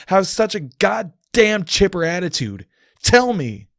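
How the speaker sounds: grieving man raging at the cheerful goddess; anguished shouting. Acted. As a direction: scream your despair at her. Anguished tirade at Celestia.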